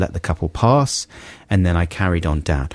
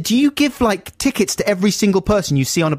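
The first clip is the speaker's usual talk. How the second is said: pitched way up in disbelief; incredulous; 11 semitones higher than usual. Retold or imitated. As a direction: squeak out the outraged question. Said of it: "I said, I said".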